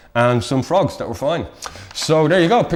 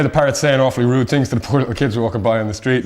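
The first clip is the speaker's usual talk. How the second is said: usual speaking voice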